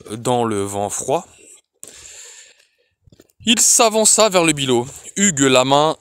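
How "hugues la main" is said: plain reading voice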